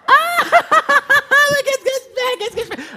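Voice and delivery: very high, loud frantic screaming; hammed-up hysterical cook; mock panic. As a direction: shriek as if time is running out. Mother panicking in timed cooking sketch.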